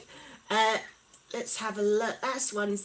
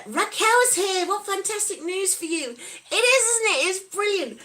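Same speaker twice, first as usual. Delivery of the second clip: delighted welcome; 7 semitones higher, 5 dB louder than usual. Real, not acted. Welcoming a favourite viewer in chat.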